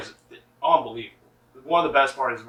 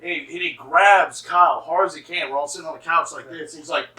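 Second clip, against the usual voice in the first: ordinary talk, nothing marked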